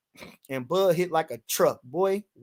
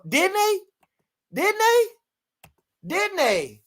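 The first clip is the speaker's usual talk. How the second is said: squeaky high, rising taunt; high taunting question; 11 semitones higher, much slower than usual. Real, not acted. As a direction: needle them in falsetto. Mock-high rhetorical taunt.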